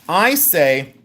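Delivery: ordinary delivery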